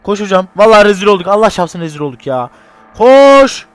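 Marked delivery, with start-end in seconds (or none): 3.0-3.7 panicked shout. Real, not acted. Fleeing monster, panicked.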